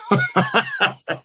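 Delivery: high laugh; laughter. Real, not acted. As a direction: laugh at the punchline. Laughing after the joke.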